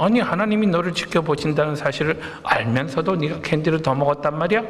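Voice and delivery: ordinary talk, nothing marked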